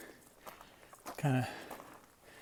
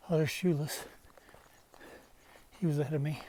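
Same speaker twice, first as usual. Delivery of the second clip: ordinary delivery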